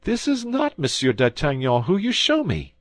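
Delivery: high, loud protest; crying out. A narrator's reading. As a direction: protest shrilly. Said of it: "cried Bonacieux".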